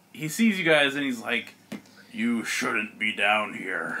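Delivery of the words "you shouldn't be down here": low, slow, quieter monster voice; a slow ogre warning intruders; deep, drawn-out menace; Ogremon villain. Acted.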